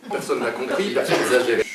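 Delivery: ordinary delivery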